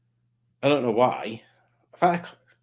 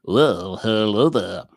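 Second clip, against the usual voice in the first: low, deep put-on greeting; Rob; 4 semitones lower, slower than usual. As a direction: drop the voice down for an impression. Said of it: "I've got to do my Rob voice".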